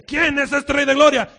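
loud, slow ringing call; proclaimed question. Acted. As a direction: call it out like a herald. Heavenly choir calls out psalm. (pregunta proclamada en voz alta)

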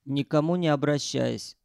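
usual speaking voice

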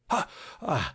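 high, half-stifled gasp of fright; startled cry. A narrator's reading. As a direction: jump with a short, half-suppressed cry. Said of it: "mit einem nur halb unterdrückten Aufschrei".

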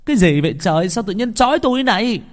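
high, loud, panicked plea. Acted. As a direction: cry out in alarm at being tied. Bound boy panicking. (giọng hoảng hốt, cao và to)

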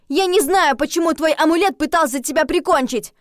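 high-pitched, loud angry yell; heated, high outburst. A narrator's reading. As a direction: shout it out, exasperated. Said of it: "то я уже орала".

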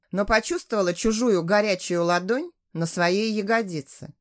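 plain reading voice

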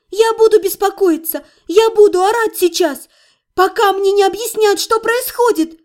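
high, agitated protest; indignant yelling. Acted. Patient demands an explanation from healer, high angry protest. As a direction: insist angrily with pitch held high.

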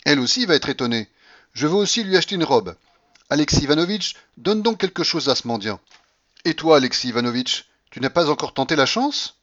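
high, loud, lively grandmother; bossy old grandmother. Acted. Grandmother orders gifts and quizzes Alexis, bossy. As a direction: rattle out orders with relish.

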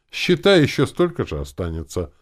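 high, loud start, gleeful; joyful. A narrator's reading. Uncle working out what is left, joyful. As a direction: declare it happily, punching the opening. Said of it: "радостно заявил дядя Фома".

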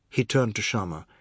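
ordinary delivery, nothing marked

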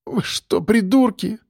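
hushed, raspy disbelief; hoarse croak. A narrator's reading. Woman appalled by the thugs' pistol, hoarse croak. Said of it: "прохрипела Олеся".

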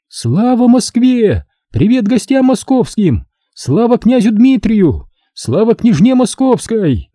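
high, ringing proclamation; ringing herald's cry. A narrator's reading. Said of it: "закричала звонко, голосом Геральда".

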